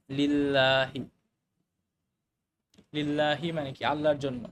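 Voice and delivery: ordinary delivery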